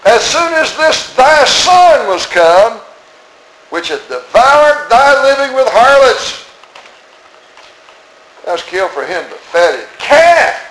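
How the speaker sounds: slow, high contempt; elder brother sneering at his brother, slow and high; resentful sneer. Acted. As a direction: spit out this with scorn. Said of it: "he didn't say that".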